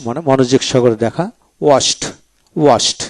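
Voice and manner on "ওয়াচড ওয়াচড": ওয়াচড said watcht, ending in t; final ED as T. Shown as a demonstration. Teacher showing the past ending, ওয়াচড with final t. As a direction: close each ওয়াচড on a crisp t. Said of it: "ট উচ্চারিত হবে".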